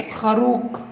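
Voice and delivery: خروق ending on a hard stop instead of f; sound substitution. Shown as a demonstration. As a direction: say خروق, final f of خروف replaced. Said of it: "أي صوت تاني".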